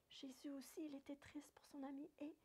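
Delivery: murmure tout bas, sans voix; chuchoté. Acted. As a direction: chuchote la tristesse de Jésus. Jésus triste pour son ami. (conteuse aux enfants, chuchotant la tristesse de Jésus)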